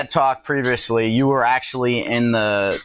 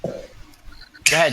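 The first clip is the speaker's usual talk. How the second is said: usual speaking voice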